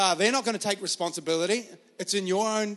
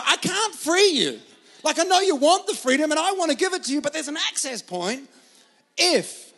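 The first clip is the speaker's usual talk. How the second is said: higher, louder pleading with a laugh; voicing God; 7 semitones higher, 6 dB louder than usual. God tells people he can't free them, higher pleading.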